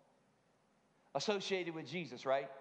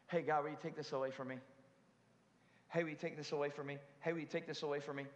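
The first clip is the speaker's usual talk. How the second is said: quick, whiny repeated plea; mock pleading; 4 semitones lower, 5 dB quieter, much faster than usual. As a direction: rattle it off like a nagging child. Mock pleading prayer, repeated fast.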